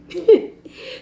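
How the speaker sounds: bright laugh breaking out at herself; real burst of laughter. Real, not acted. Laughing at herself while telling the spa story, a real burst of laughter.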